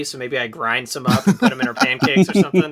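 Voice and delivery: ordinary talk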